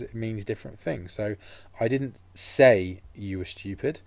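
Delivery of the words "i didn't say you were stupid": slow sentence, lean hard on the word SAY; stress on SAY. Shown as a demonstration. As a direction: SAY set off and drawn out, slow. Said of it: "put the stress into different places".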